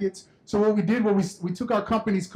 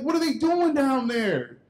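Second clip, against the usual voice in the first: high, drawn-out mock bafflement; incredulous kid; 6 semitones higher than usual. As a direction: stretch the question out high in disbelief. Boy puzzled again, an hour later.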